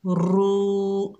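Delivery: रू with the u stretched one alif long; drawn-out vowel. Shown as a demonstration. Teacher shows the madd on रू, stretched oo. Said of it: "एक अलिफ की मिकदार खींचकर पढ़ेंगे".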